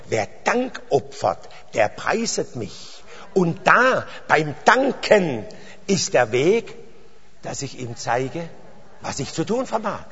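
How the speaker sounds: verse recited slowly and deliberately; measured, unhurried scripture quote; slow recitation. Real, not acted. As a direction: spell out the verse phrase by phrase, stretching the key words.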